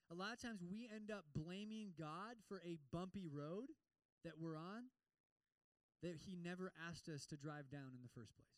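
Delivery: ordinary talk, nothing marked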